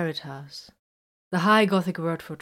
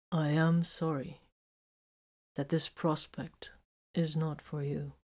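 slow, much softer apology; quietly; 6 dB quieter than usual. A narrator's reading. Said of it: "he said quietly".

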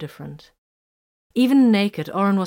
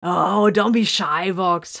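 slow, louder mocking call-out; teasing call; 6 dB louder, slower than usual. A narrator's reading. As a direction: tease him across the room. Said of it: "Hiram called out".

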